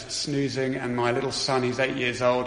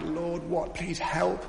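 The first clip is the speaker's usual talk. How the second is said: higher, soft, breathless plea to God; a quiet, desperate cry for help; desperate prayer; 6 semitones higher, 4 dB quieter, slower than usual. Retold or imitated. Praying at passport control, desperate and hushed. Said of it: "They were desperate".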